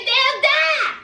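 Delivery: high imitated wail; mock crying. Son cries to his mama about his kids, mock crying. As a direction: retell a tearful outcry, hammed up. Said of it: "he starts crying, and he says".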